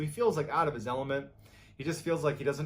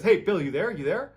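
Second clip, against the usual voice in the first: raised, high urgent check-in; calling out; 6 semitones higher, 9 dB louder than usual. Retold or imitated. Michael checking his friend is still on the phone, calling out. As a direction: call down the line to see who's there.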